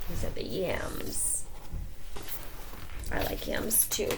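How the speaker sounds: ordinary delivery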